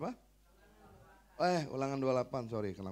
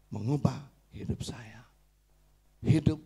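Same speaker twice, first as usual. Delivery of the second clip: ordinary talk, nothing marked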